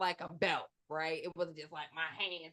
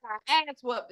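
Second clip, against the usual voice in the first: hyped boast; 9 semitones higher, 7 dB louder, faster than usual. Retold or imitated. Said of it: "I be coming in the door like".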